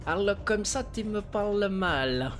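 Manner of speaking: slow, hammed-up Italian godfather accent; parodying a godfather with a fake Italian accent; mock Italian mafia accent. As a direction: mimic a bad Italian mobster accent. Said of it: "il essaye de faire l'accent italien".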